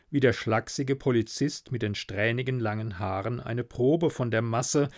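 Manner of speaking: ordinary delivery, nothing marked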